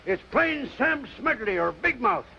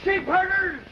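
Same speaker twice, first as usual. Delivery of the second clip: put-on old-timer hollering at card sharps, outraged; indignant yelling; 12 semitones higher, much slower than usual. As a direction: bellow insults at the cheats. Grandpa yelling at card cheats.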